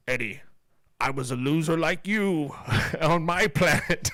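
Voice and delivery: slow, mock Venom voice with a laugh breaking in; a light imitation of the monster's line; imitating Venom. Retelling the movie to the host as Venom, slow and mocking. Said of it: "Venom goes".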